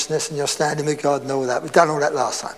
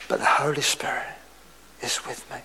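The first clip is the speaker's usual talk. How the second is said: half-whispered, low and much softer; hushed; 5 semitones lower, 9 dB quieter, faster than usual. Real, not acted. Preacher assuring the congregation of the Spirit's company, hushed. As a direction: drop to a reverent near-whisper. Hushed contrast after loud narrow road.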